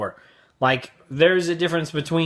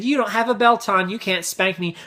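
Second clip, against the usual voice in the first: higher, cocky mimicked child; bratty kid; 6 semitones higher than usual. Kid defies dad at the beach, high bratty voice. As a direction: taunt dad as a little brat.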